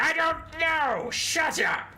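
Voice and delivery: high, loud, slow angry screech; shrieking rat warlord yelling back; furious ratman snap. Acted. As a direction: bite his head off. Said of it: "And Ickett's like".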